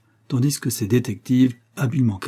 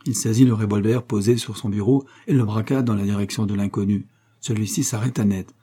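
ordinary delivery

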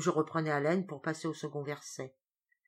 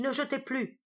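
high, urgent command; shouted order; 8 semitones higher than usual. Acted. The skipper ordering the crew to stop throwing. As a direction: call out to stop them throwing. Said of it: "criai-je".

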